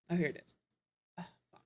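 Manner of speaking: holding back hiccups mid-sentence; suppressing hiccups. Real, not acted. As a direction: talk through the hiccups. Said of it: "I have the hiccups".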